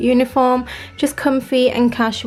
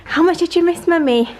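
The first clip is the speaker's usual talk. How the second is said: welcoming someone home; 5 semitones higher than usual. Real, not acted. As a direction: gently coax your little one. Talking to her child.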